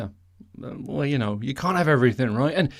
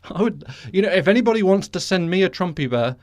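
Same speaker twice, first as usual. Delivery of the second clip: ordinary talk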